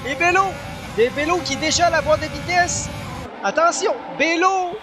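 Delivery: rising urgent call; motorsport commentator. Real, not acted. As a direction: lift on each name. Bello attacks. (commentateur qui monte en tension)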